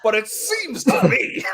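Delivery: high, loud, drawn-out Southern American drawl. Acted. Auditioning as a lawyer pleading to the court, Southern American accent. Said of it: "like, Southern American".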